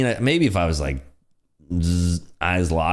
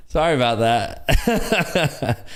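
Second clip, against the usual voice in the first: laughing; 7 semitones higher, much slower than usual. Real, not acted. Apologizing through laughter.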